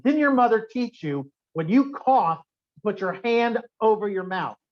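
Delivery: hammed-up scolding from a stranger; mock scream. Woman in the elevator scolding him for coughing, mock yell. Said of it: "this woman in the back screamed at me".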